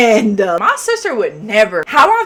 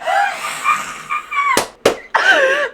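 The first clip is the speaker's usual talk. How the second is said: hysterical gasping laugh. Retold or imitated. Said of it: "starts laughing hysterically".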